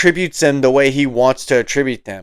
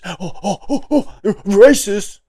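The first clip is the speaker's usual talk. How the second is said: mock sputtering; 5 semitones higher than usual. Mocking the host's lame comeback, high sputtering. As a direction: play him lost for words, then blurting.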